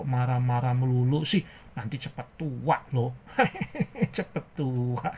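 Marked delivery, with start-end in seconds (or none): 3.2-4.1 high little chuckle; playful short laugh; teasing giggle. Acted. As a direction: giggle mockingly at them. Teases about getting old.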